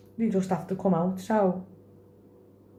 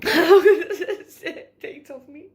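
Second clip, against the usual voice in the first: laughing; 10 semitones higher, 8 dB louder, much slower than usual. Real, not acted. Laughing embarrassed while crying.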